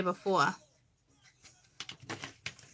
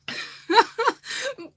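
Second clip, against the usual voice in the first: quick high giggle; bright giggle burst; high laugh; 14 semitones higher than usual. Real, not acted. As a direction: laugh at your own joke. Laughing at deaf joke.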